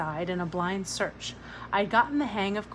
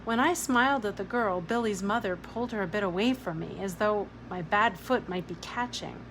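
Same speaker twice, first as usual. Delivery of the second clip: usual speaking voice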